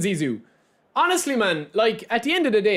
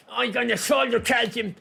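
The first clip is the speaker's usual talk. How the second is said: 1 mocking gamer voice. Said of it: "these guys are sitting at home".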